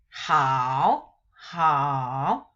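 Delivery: "hao" dipped low and rising; third tone hao. Shown as a demonstration. Teacher reading hao with its accent mark. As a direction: sink low on "hao" and lift at the end. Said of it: "we read as".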